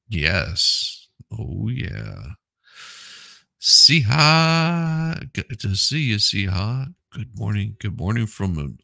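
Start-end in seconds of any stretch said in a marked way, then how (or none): 3.6-5.3 higher, louder name call; called-out greeting. Real, not acted. Hailing a viewer in chat, louder and higher. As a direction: call her name out warmly.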